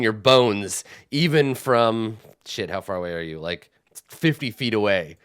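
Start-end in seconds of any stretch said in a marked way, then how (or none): none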